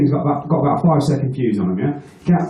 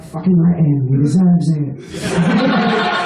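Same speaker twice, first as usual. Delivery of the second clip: laughing hungover voice; 2 semitones higher, much slower than usual. Retold or imitated. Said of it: "the hungover part of me is like".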